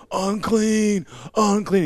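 a leper crying a warning to passers-by; leper calling out. Retold or imitated. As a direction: call out so people keep away.

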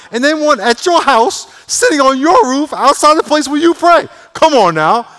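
high, very loud sustained preacher's shout; full-force preaching. Real, not acted. Preacher urging the congregation on, shouting at full force. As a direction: belt it out to the congregation.

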